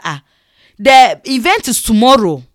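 loud, startled protest; retold outburst of alarm; shocked exclamation. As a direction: blurt it out in loud disbelief. Retells shock at wrong day.

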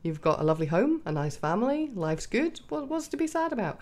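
exasperated parent, half-mocking her own impatience; frustrated disbelief. Scene: mother to ten-year-old daughter, exasperated disbelief. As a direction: replay your own frustrated reaction, lightly. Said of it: "I get frustrated with that, 'cause I'm like".

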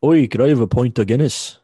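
loud, hammed-up Irish accent; cartoonish Irish brogue; mock Irish accent. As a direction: bellow a pub order in fake Irish. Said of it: "like, an Irish accent".

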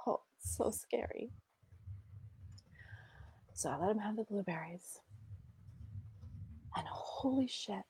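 very soft, nearly whispered; sighing, hushed and daunted; scared hush. Retold or imitated. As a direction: relive the dread under your breath. Reliving fear of the blueberries.

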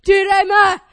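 high, loud imitated dad yell; dad's repeated shouting. Dad calling the puppy for ages, shouting. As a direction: keep shouting for a dog that won't come. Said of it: "he's there for a good ten minutes".

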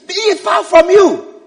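high, slow, emphatic reproof; forceful rebuke. Acted. Peter takes Jesus aside to correct him, forceful rebuke. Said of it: "began to rebuke him".

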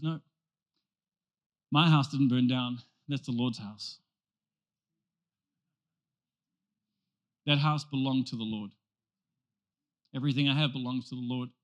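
low, quiet, unbothered; Wesley calmly correcting the messenger; serene Wesley. Retold or imitated. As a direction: settle him down gently, no alarm.

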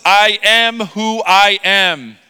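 slow and weighty; solemn slow reading. Acted. God answering Moses, slow and solemn. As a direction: let each word land. Reading God's words slowly.

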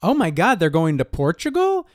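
high, loud gasp of disbelief; shocked excitement. Retold or imitated. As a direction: squeal it like juicy gossip. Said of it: "you're like".

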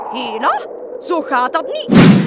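the witch scolds her sputtering broom; indignant scolding. A narrator's reading.

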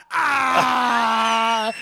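high shouted "Ah"; mocking crowd yell. Bar crowd jeering at the two losing fans, yelling. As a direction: jeer back like the whole bar. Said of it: "the entire bar turns to me".

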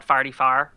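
forty-four said "farty-far"; North County pronunciation. Retold or imitated. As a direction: turn both "or" sounds in forty-four to "ar". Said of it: "highway here called forty-four".

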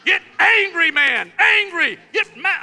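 high, loud demon egging someone on; hammed-up demonic urging; goading demon. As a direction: shout the temptation, stretching the words. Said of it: "A demon straight from the pits".

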